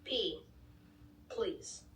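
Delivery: ordinary delivery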